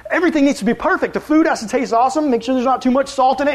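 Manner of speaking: high, loud, flustered hostess voice; mock-frantic Martha fretting over the house. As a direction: panic about the perfect house. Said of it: "she's, like, freaking out".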